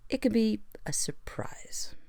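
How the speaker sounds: slow, whispered dry aside. Acted. Analyst mutters to herself about the stable, slow whisper. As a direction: say it wryly under your breath.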